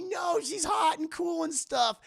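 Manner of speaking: high, loud, slow mock whine; lovestruck protest. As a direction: object like a besotted fool. Casey refuses to shoot her.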